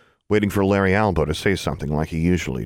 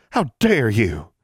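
slow, higher outraged rebuke; indignant exclamation; 4 semitones higher, slower than usual. A narrator's reading. Forbearance rebukes Mordecai for threatening her friend, slow and indignant. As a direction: lean slowly on each outraged word. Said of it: "Forbearance exclaimed".

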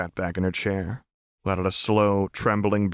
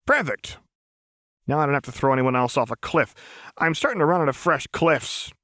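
high, bouncy relief; gleefully casual threat; Jinx, cheerful menace; 7 semitones higher than usual. A narrator's reading. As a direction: rattle it off brightly, as if murder were a chore.

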